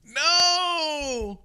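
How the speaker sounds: high, loud cry of dismay; loud protest. Real, not acted. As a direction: shout no in disbelief. His favourite gift ranked second.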